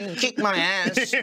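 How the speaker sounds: slow, high, pleading little sidekick; Wormmon. Acted. Loyal Wormmon pleading with Ken, mock-pleading. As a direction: draw it out high, playfully devoted. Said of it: "I'll always be there for Wormmon".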